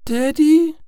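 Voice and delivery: put-on very high, loud, questioning child voice; small daughter calling out, startled; little girl. A narrator's reading. Startled daughter calls her father, little girl voice.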